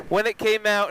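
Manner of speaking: ordinary talk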